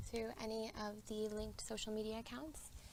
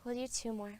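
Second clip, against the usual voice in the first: louder, open breathing cue; yoga breathing cue; 6 dB louder than usual. Acted. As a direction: call the sigh out to the class. Cues a big breath.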